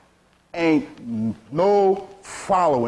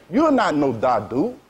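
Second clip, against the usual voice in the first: normal talking voice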